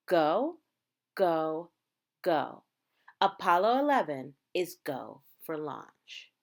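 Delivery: normal talking voice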